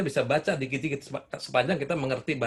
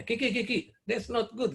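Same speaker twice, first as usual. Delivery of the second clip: higher, lightly voiced little grandchild telling grandpa off; mock child scolding; 9 semitones higher, 4 dB quieter than usual. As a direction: imitate the small grandson's cross little reproach, not too hard. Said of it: "marah-marah gitu".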